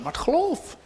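ordinary talk, nothing marked

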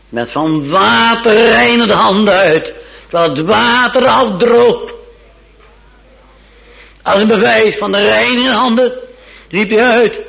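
gedragen, luide preekstem; fervent preaching. Acted. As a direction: verhef de stem als op de kansel. Preaching Pilate's hand-washing scene. (luid en plechtig preken)

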